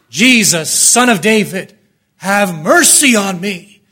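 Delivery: drawn-out shouted plea; screaming cry. Acted. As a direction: cry out hysterically for mercy. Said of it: "This man is crying out, screaming repeatedly".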